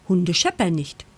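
plain reading voice

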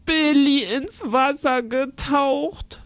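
put-on high, tearful little girl's voice; a child's wailing complaint, slow; crying girl. Girl accusing her cousin to the aunt, tearful. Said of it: "weint Käthe".

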